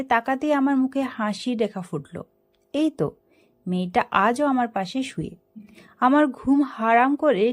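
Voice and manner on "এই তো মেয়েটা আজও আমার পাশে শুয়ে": ordinary delivery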